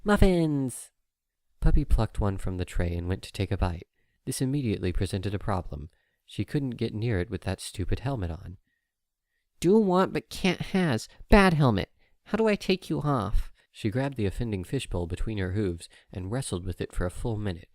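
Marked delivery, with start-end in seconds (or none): 0.0-0.9 high, loud delighted cry; filly finding muffins in the fridge; excited filly. Acted. 9.6-13.5 high, loud, slow whiny filly; filly fighting her helmet for a muffin; frustrated filly. Acted.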